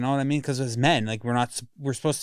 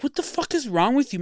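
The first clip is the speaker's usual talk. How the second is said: mock angry outburst, pitched much higher; retold outburst at parents; 11 semitones higher than usual. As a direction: replay the snap at his parents, high and strained. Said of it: "and I'm like".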